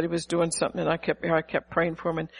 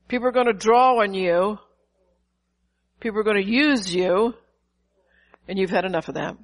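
ordinary delivery

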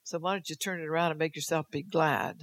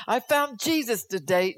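higher, louder mock voice of a convert; mocking quote; 6 semitones higher, 6 dB louder than usual. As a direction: parody the proud believer's claim. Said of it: "How many people have you heard say".

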